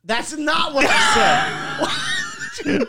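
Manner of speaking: high-pitched, incredulous yell, cracking up; outraged laughing protest. Real, not acted. Friend objecting to what he supposedly said, laughing shout.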